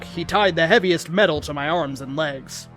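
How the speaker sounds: ordinary delivery, nothing marked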